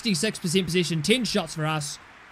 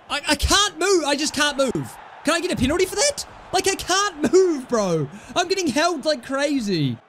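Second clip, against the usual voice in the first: frustrated complaint; 7 semitones higher than usual. Real, not acted. Player held, can't move.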